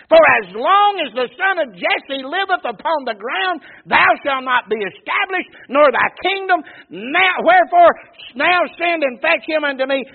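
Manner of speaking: loud, high-pitched raging read, climbing to a yell; a king screaming his rage at his son; furious shout. Acted. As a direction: thunder the king's threats from the pulpit. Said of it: "You think somebody's upset?".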